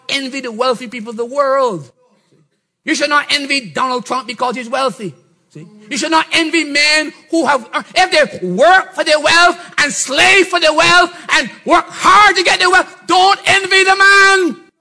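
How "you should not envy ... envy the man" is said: preaching shout. Real, not acted. Preaching at full force.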